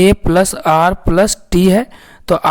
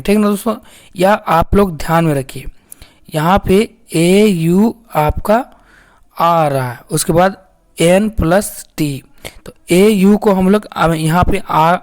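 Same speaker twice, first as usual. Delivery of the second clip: ordinary talk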